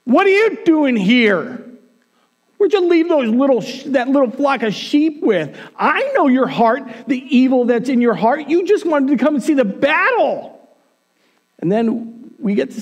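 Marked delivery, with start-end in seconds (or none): 0.0-10.6 raised, high-pitched big-brother scolding; acted angry older brother telling someone off; angry yelling. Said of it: "Eliab starts yelling at David".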